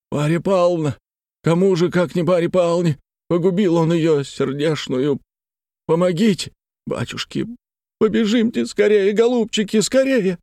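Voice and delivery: high frantic cries for help; distraught, high-pitched urging; panicked plea. A narrator's reading. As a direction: beg them to hurry, voice climbing. Same panicked man begs for help.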